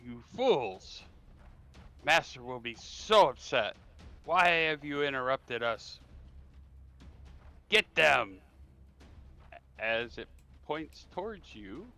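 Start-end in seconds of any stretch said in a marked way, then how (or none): 0.1-8.4 loud, angry scolding that builds to a command; yelled villain outburst. Acted. Caster berating the intruders and ordering an attack, yelling. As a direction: yell it out like a furious cult caster. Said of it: "is going to yell out".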